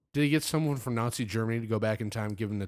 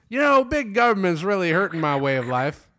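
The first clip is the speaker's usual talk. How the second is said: mock complainer; 6 semitones higher, 8 dB louder than usual. Mock anti-government complainer voice.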